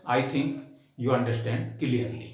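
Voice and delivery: ordinary talk, nothing marked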